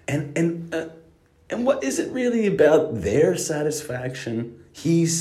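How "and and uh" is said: normal talking voice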